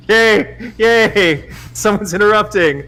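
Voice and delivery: high-pitched delighted cheering; gleeful cheer. Real, not acted. Celebrating that someone interrupts the love scene, gleeful high cheer. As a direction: cheer at being rescued.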